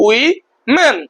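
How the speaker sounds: Women in two separate beats, wi and men; split in two. Shown as a demonstration. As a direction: pause between the two syllables of women. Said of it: "দুটো খণ্ডে ভাগ করতে পারি".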